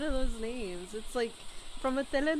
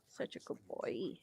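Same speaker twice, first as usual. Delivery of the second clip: whispered tenderly; soft whisper to the pet; 11 semitones lower, 13 dB quieter than usual. Real, not acted. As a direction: breathe it softly to the cat.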